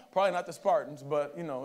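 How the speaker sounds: usual speaking voice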